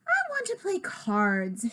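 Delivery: little sister voice. Acted.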